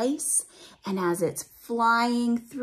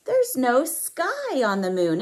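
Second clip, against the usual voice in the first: plain reading voice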